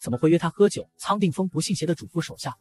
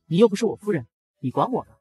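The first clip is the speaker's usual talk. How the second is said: usual speaking voice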